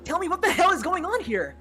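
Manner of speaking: high, loud furious argument; angry yelling. Acted. Acted quarrel, yelling.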